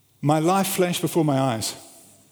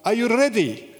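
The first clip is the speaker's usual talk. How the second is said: truck driver yelling up, higher; retold yell from down below; calling out; 7 semitones higher than usual. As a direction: call it up to someone on a roof. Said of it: "he yells out".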